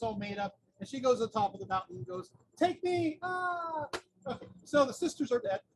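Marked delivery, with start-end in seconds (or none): 2.6-3.2 higher, louder cry to the wind; calling out. Retold or imitated. Second sister calls from mountain. 3.2-3.9 short high cry of a fall; mock scream as she drops. Second sister falls from the peak.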